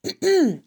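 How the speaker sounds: short, deliberate throat-clearing. A narrator's reading. Pig preparing to address the barn, clearing his throat. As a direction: clear the throat before an announcement. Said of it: "Wilbur cleared his throat".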